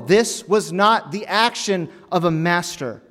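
high, loud sermon climax; loud, driving pulpit proclamation; forceful preaching. Acted.

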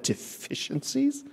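slow, high, quiet echo; hurt pride, echoed back softly; offended disbelief. Retold or imitated. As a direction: repeat the word as if it stings. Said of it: "my pride swelled up with it".

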